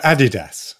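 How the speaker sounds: Adidas with first-syllable stress, AD-i-das; front-stressed Adidas, short middle i; British Adidas. Shown as a demonstration. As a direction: pronounce Adidas as AD-ih-dass, British style. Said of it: "in Britain, we call it".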